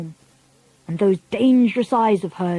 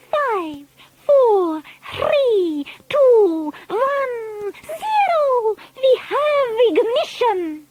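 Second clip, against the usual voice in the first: rising countdown; 14 semitones higher, much slower than usual. A narrator's reading. Grand High Witch counting down to ignition, ever higher. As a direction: pitch climbing higher with each number.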